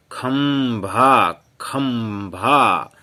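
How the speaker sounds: खंभा drawn out very slowly, syllable by syllable; slow syllable reading. Shown as a demonstration. Teacher spelling out a word for pupils, slow and drawn out. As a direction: read खंभा slowly for the class. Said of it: "पढ़ते हैं हम guys फिर से".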